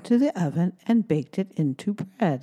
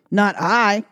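louder quacking duck; 2 semitones higher, 5 dB louder than usual. Acted. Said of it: "quacked the duck".

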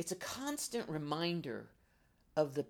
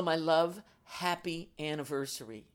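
ordinary talk, nothing marked

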